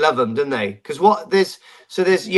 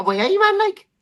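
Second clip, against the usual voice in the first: exaggerated Geordie accent, pitched much higher; mock a Sunderland local with a broad Geordie lilt; high-pitched jokey Geordie impression. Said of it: "up north, they talk".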